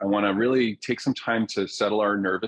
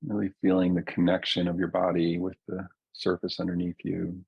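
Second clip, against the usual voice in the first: guided meditation. Real, not acted. Teacher settling a group into their bodies, calm guided meditation. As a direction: ease listeners down gently, leaving long silences. Leads a slowing-down grounding meditation.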